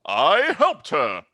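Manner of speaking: loud theatrical gloating, rising at the end; gloating villain. Acted. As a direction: rant and build to a triumphant roar. Game master acts gloating villain manager.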